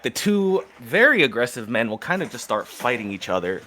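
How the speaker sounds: ordinary delivery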